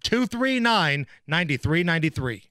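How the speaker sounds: upbeat DJ promo read; radio contest promo. Acted. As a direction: sell the contest, stretch numbers.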